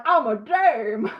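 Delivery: slow, hammed-up film noir dame. Joking aside from the book, hammy film noir voice. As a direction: drag it out like an old noir movie. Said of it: "film noir where it's like".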